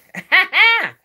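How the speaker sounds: a short, sharp, high burst of laughter; bitter laugh. Real, not acted. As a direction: laugh out loud at the absurdity. Laughing mid rant.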